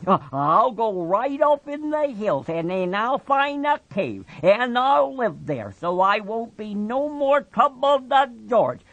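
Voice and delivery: put-on high childlike man's voice, promising; Lenny pleading. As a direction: stammer the promise like a scared child.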